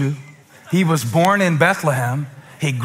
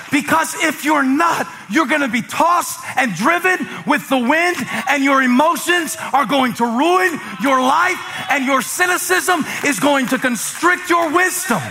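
high, driving preacher's warning; fiery preaching; 9 semitones higher than usual. Acted. Pastor warning the congregation, in fiery preaching. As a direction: press the warning home from the pulpit.

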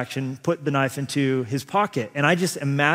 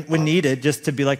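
usual speaking voice

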